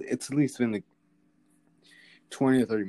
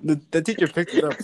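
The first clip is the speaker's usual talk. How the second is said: higher and louder, eager; excited; 8 semitones higher, 7 dB louder than usual. Real, not acted. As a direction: push it out excitedly. Defending the joke excitedly.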